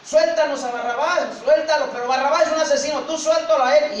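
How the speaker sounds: clamor like an inflamed crowd; insistent crowd yell; enraged mob shouting. Acted. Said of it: "la turba enardecida gritaba".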